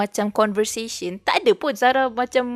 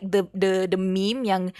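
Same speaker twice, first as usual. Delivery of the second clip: ordinary delivery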